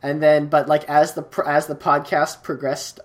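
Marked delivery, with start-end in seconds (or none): none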